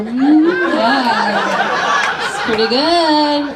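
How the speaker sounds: high, drawn-out mock-seductive purr; hammed-up flirting. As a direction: ham up the come-on, slowly. Flirting by showing off to Billy.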